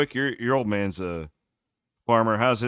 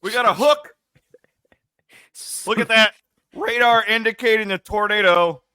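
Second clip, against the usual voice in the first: panicked, pitched-up storm chaser; frantic high yelling; 12 semitones higher, 9 dB louder than usual. Retold or imitated. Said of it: "I'm in the background like".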